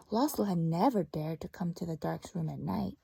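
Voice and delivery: story narration. Acted. Teacher reads story to class.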